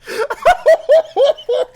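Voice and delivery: loud, very high helpless laugh; shrieking laughter. Real, not acted. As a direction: laugh till breathless. Breaks down laughing.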